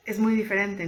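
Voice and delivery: usual speaking voice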